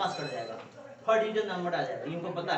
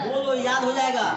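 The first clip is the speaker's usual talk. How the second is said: louder, slower push to recite; urging call; 3 semitones higher, 9 dB louder than usual. Real, not acted. Tutor urging his student to say it aloud, louder and slower. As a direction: call it out so he repeats it.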